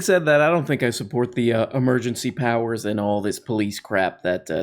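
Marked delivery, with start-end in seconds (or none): none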